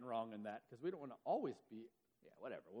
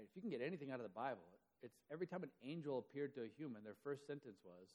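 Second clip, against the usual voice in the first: ordinary talk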